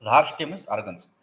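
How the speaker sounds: normal talking voice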